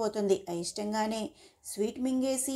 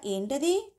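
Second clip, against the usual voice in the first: offhand, higher-pitched question; careless; 5 semitones higher, much slower than usual. A narrator's reading. Janu responds to Surya's request to talk, carelessly. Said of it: "రెక్లెస్గా".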